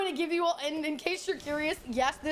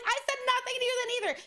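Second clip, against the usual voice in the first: high, rapid retold protest; exasperated denial; 9 semitones higher, much faster than usual. Streamer retelling her denial to the accuser, exasperated. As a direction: rattle it off fast and high.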